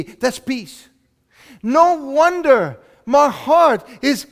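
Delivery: loud, high, fervent preaching; preaching at full force. Acted. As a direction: preach it out to the whole room, slowed on key lines.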